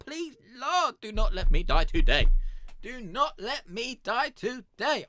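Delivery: high, loud, desperate pleading to God; desperate prayer. Acted. Boss man begging God in the woods, frantic plea. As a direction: beg the Lord for your life, voice cracking up high.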